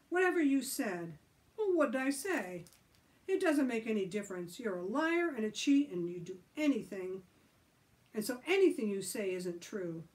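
loud angry arguing. Acted. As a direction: quarrel heatedly. Angry quarrel.

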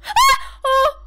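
very high, loud shriek of fright; real jump-scare yelp; startled scream. Real, not acted. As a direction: scream as if ambushed, then a loud "oh".

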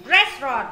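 restaurant with a loud opening syllable; stress on first syllable. Shown as a demonstration. Teacher repeating restaurant for students, loud on the first syllable. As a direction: punch "res", let the rest of restaurant fall away. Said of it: "restaurant là âm một".

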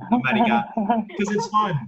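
America said the Thai way, ending in ah; playful Thai-accented America. Shown as a demonstration. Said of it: "I use a Thai accent".

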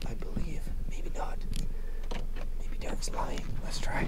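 breathy aside under the breath; whispering. Real, not acted. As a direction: whisper it to yourself. Muttering aside while testing seats.

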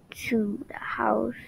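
whisper. Acted. Sneaking to the house.

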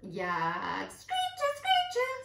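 very high, loud parrot squawk; squawking macaw. A narrator's reading. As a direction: screech like a macaw. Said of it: "squawked a macaw".

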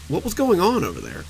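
ordinary delivery, nothing marked

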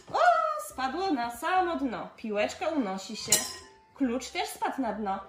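usual speaking voice

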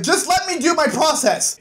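high-pitched, exasperated pleading; exasperated retort. Acted. Father's irritated reply in retold quarrel.

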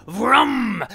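one long, loud, rising vroom; chainsaw roar. Acted. Leatherface swings as Jason's guard drops, loud chainsaw roar. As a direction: rev it suddenly and hold it.

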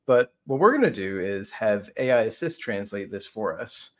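normal talking voice

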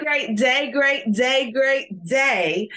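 loud, high, rising call-and-response opener; hype greeting. Real, not acted. Speaker opening a session, pumping up the audience with a loud, high greeting. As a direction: fire up the crowd.

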